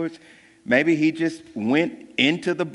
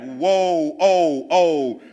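mild mock awe; 4 dB louder, much slower than usual. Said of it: "they're like".

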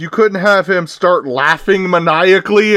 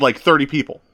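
usual speaking voice

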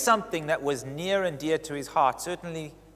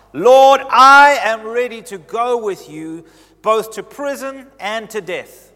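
loud, slow, high fervent pledge; fervent vow; 6 semitones higher, 15 dB louder, slower than usual. Retold or imitated. Peter pledging loyalty to Jesus, loud and slow. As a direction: swear it with all your heart.

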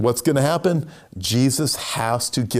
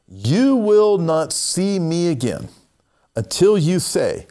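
slow, higher, weighty Jesus voice; solemn prophecy; 5 semitones higher, slower than usual. Acted. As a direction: pronounce it slowly as a solemn warning.

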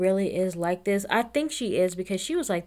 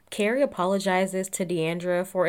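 usual speaking voice

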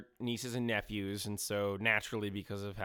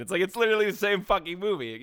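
exasperated outburst; 9 semitones higher, 7 dB louder than usual. Real, not acted. Mocking the film's sameness.

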